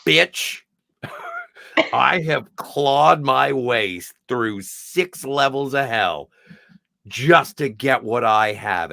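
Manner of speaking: acted icy warning, measured word by word; calm but stern. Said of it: "she says it so calmly, but sternly".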